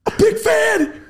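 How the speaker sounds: high, loud fanboy blurt; excited shout. Retold or imitated. Telling the actor he's a fan, excited shout. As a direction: gush it out loud like a starstruck kid. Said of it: "turn around and go".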